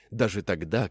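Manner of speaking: ordinary delivery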